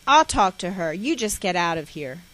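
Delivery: loud firm, then settling; stern dismissal. Retold or imitated. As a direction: send her out firmly. Father sending mother away.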